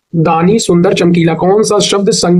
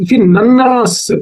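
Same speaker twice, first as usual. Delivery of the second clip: slow, higher, syllable by syllable; slow spelling out; 4 semitones higher, much slower than usual. Shown as a demonstration. Teacher breaking अन्ननास into parts, slow and higher. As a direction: draw out नन्नास slowly so students hear each sound. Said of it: "देखिए अन्न".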